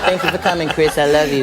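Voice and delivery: lightly imitated cool, low voice. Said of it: "he was like".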